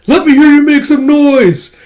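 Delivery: high, loud, slow hype call. Acted. Classmate hyping old friends at karaoke like an MC. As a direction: work the crowd at karaoke.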